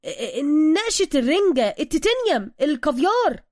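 loud, high flustered guesses; hasty, stammering reply. A narrator's reading. Suka scrambling to answer Bruce, flustered and loud. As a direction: stumble in, then blurt each word. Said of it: "سوكا مسرعًا بالرد".

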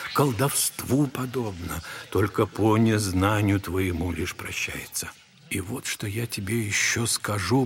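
usual speaking voice

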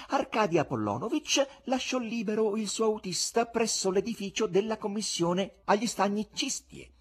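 high-pitched, gleeful showman's exposé; insolent buffoon. Acted. Buffoon exposes the husband to his wife, insolent. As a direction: correct her with relish, voice held up high.

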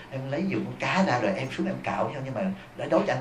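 soft, sweet coaxing plea to a husband; a wife sweetly asking her husband for help, softly coaxing; sweet-talking. Retold or imitated. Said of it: "lời nói mà quý vị dịu ngọt á".

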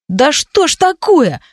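aggrieved high-pitched whine; brother's indignant outburst. A narrator's reading. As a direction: complain in a high, wounded voice. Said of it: "взбрыкнул брат".

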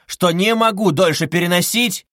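loud, high-pitched exasperation; furious cry. A narrator's reading. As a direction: burst out at breaking point. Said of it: "вскричал в ярости Раскольников".

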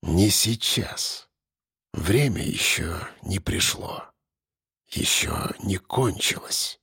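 low, very soft and slow; hushed icy answer, drawn out slowly; quiet reply. A narrator's reading. Captor answers his frightened victim, quietly. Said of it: "ответил тихо".

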